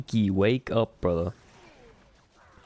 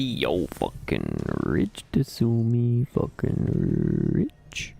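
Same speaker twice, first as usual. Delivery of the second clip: much lower and very slow mock envy; mock rich voice; 7 semitones lower, much slower than usual. Streamer mocking a teammate's lucky drop, low slow mock awe.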